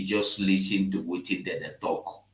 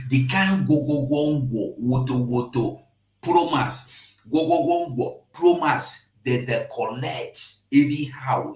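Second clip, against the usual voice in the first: ordinary talk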